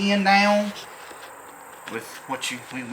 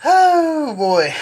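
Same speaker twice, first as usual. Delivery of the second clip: surprised; 11 semitones higher, 6 dB louder than usual. Real, not acted. Drilling a stubborn bolt.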